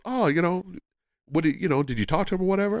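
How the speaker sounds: high, light voice for the wife; imitating his wife. Wife asking her husband about the champ, in a mock high voice. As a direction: lift the voice high as the curious wife. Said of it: "and she goes".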